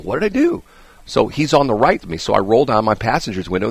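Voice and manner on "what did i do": puzzled. Retold or imitated. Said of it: "I'm going".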